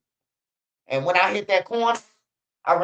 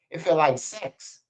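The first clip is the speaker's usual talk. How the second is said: imitating the boy. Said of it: "You heard that boy say".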